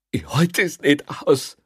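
high, slow, strained plea; anguished confession. A narrator's reading. Prisoner admitting he can't bear confinement, anguished. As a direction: confess it slowly in distress.

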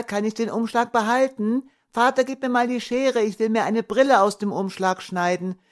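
put-on high, bright boy's pleading; a five-year-old wanting his way; little boy begging for the envelope; eager child's voice. A narrator's reading. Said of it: "Thomas' helle Stimme".

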